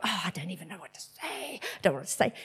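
nervous fretting. Retold or imitated. Dreading talking to the couple, soft quick anxious fretting. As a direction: fret to yourself before approaching strangers.

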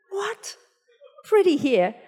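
high, baffled reaction; incredulous. Retold or imitated. Retelling disbelief at God's odd instruction.

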